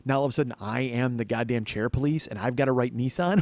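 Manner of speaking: ordinary delivery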